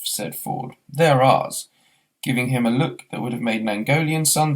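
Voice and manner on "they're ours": louder, firm claim; guard the pints firmly; louder mock-firm they're ours; possessive.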